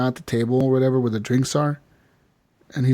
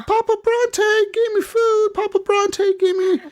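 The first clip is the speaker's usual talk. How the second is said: put-on much higher, slow, wide-eyed kid voice; little Jack's voice. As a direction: play an excited little boy. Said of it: "and he's just like".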